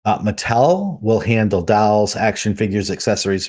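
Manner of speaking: normal talking voice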